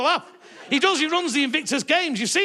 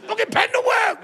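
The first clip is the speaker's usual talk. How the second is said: angry king shout; 9 semitones higher than usual. Retold or imitated. The king yelling in rage at a leaky pen, a shouted tantrum. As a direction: yell it like the king losing it over a pen. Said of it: "'Cause you're not even the king yet".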